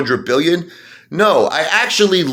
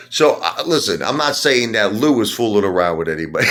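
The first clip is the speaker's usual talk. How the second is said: ordinary delivery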